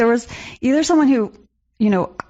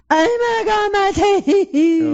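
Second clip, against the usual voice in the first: high, hammed-up wail of pain; exaggerated pained caller voice; mock agony; 9 semitones higher, much slower than usual. Imitating dentist-line callers in pain.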